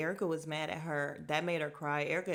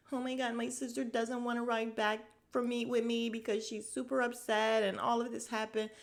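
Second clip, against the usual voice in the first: mock confessional; 5 semitones higher than usual. Mocking sister's confessional.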